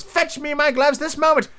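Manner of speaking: high, imperious character voice; high-voiced bossy Rabbit; Rabbit giving orders. A narrator's reading. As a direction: order a servant about in a high-pitched voice. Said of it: "said the voice".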